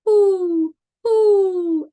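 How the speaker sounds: high, loud falling hoots; owl hooting. Acted. Owl asks the spider, high drawn-out hoots. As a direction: hoot the word like an owl. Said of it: "asked the owl".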